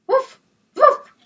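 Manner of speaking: high, loud yappy puppy woof; happy puppy yapping at everything; puppy barking. Acted. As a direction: bark it like an excited little dog. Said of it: "he barked all day long".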